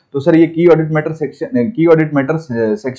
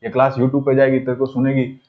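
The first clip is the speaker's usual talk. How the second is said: teasing aside; 4 semitones lower, 6 dB quieter than usual. Real, not acted. Teacher teasing a student aside.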